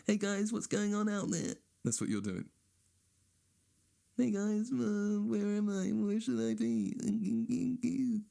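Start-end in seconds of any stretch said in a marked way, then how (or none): 0.1-1.6 high whiny mock texter; mock needy texter. Comedian mocking friends who text him, high whiny voice. 4.2-8.2 high, slow, dopey lost voice; mock lost texter. Comedian mocking a lost texter, high slow dopey voice.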